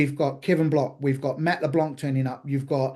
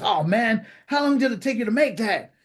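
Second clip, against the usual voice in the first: higher-pitched mock American accent; annoying American; 7 semitones higher than usual. The American asking about the platter, annoying American accent. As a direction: play an over-keen American stranger. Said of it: "this annoying American".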